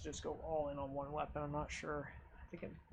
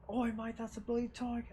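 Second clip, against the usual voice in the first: higher, light Cockney-style British; lightly put-on British alarm; mock British accent; 6 semitones higher than usual. Gamer spotting a tiger, mock British accent. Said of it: "British accent".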